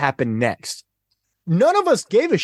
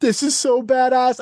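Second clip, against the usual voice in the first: much higher, thrilled fanboy gasp; squealing with delight at the scene; retold excitement; 10 semitones higher than usual. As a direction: relive the thrill of the moment. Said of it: "And I'm like".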